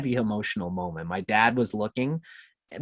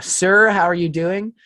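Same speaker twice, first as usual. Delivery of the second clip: cop voice; 6 semitones higher, 5 dB louder than usual. Retold or imitated.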